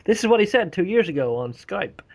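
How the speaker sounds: imagined news exposé. Retold or imitated. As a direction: quote it back like a damning revelation. Imagined BBC quoting his words back.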